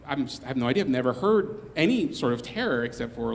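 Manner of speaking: ordinary delivery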